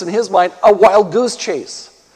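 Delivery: ordinary talk, nothing marked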